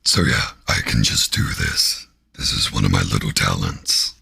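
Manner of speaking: very low, growling demon voice; deep, rumbling demon growl. Pretend. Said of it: "Five minutes of my demon voice".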